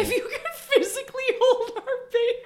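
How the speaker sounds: high, loud laughing correction; laughing retort. Real, not acted. Wife correcting Michael's answer, laughing. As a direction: laugh at his dodge.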